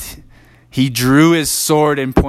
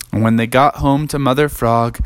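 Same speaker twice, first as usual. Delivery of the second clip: usual speaking voice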